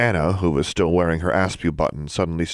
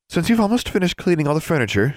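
higher-pitched lady librarian voice; prim librarian; 11 semitones higher, faster than usual. A narrator's reading. Librarian assigning a student's detention work, prim high voice. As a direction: give orders as a librarian.

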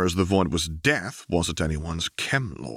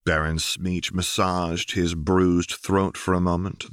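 ordinary delivery

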